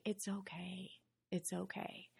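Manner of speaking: very soft, hushed reassurance; gentle reassurance. Retold or imitated. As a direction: softly comfort her, nearly under the breath. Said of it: "God just say".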